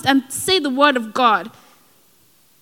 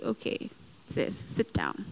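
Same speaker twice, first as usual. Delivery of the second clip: whispered, low "sit down"; hushed dismissal; 5 semitones lower, 18 dB quieter than usual. Retold or imitated. God telling her to calm down, hushed. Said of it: "it's like".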